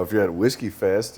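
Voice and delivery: normal talking voice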